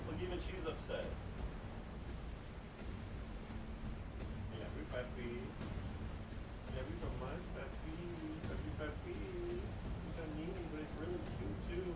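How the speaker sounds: soft, high cooing to a cat; tiny high pet voice, very soft. Real, not acted. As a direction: coo lovingly at a pet, away from the mic. Dotes on cat away from mic.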